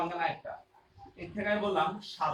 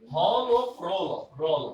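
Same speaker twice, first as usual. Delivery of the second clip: হল ও প্রল slowly and louder; slow, stressed; 2 semitones lower, 6 dB louder, much slower than usual. Shown as a demonstration. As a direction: spell out হল ও প্রল slowly, word by word. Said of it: "একটু ভালো করে বুঝে নিই". (ধীরে জোর দিয়ে হল ও প্রল)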